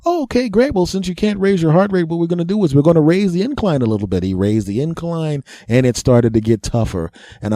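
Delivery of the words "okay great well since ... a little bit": high chirpy doctor voice; perky, fast doctor. Retold or imitated.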